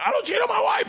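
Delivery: high, yelled self-reminder; mock yelling. Husband resisting temptation, mock yelling to himself. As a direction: shout it at yourself. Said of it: "I end up yelling".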